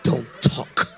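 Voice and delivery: sharp shushes with breathy whispers; reverent shushing. Acted. Stern churchgoer silencing people in church, mock reverent shushing. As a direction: hush everyone in a solemn whisper. Mimics stoic hushing in church.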